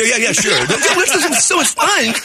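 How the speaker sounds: slow, high, laughing through it. Real, not acted. Joking about stink bombs, slow high laughing delight. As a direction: giggle the words out slowly.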